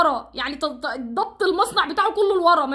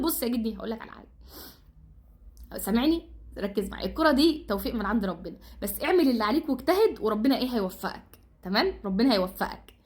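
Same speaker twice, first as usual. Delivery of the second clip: softer and lower, coaxing; gentle reassurance; 4 semitones lower, 4 dB quieter than usual. Real, not acted. Fan quietly reassuring coach before the match, soft and low. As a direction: soothe him like a worried friend.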